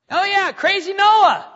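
high, loud scoffing jeer at Noah; mocking neighbor. Neighbor ridiculing Noah, loud high mocking jeer. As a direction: sneer it like a townsman mocking him. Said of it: "Be mocked. Criticized".

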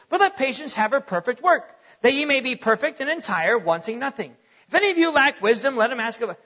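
hurried, run-on recitation; rushed Bible reading. Shown as a demonstration. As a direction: race through the verse without pausing. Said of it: "we just missed everything".

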